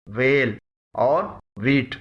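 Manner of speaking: ویل and ویٹ said slowly, h silent; correct pronunciation, slow. Shown as a demonstration. Teacher slowly models the correct ویل and ویٹ. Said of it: "ان کا صحیح تلفظ ہے".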